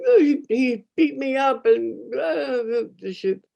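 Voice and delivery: high, drawn-out mock wailing; imitated crying. Crying kid telling father about a beating, hammed-up wail. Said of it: "I'm crying".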